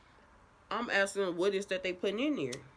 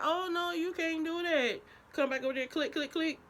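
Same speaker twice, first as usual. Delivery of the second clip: nurse voice; 12 semitones higher than usual. Retold or imitated. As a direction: play the scolding nurse, pitched up. Nurse voiced in retold story.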